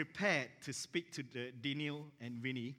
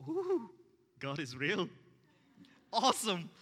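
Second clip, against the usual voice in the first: high ooh, loud thrilled exclamation; delighted; 4 semitones higher, 6 dB louder than usual. Retold or imitated. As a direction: burst out in joyful relief. Delight at the couple's yes.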